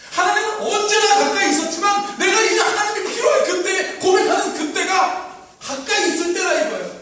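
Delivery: loud, high-pitched preaching; predica accorata. Real, not acted. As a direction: preach it out at full force. Preaches at full force.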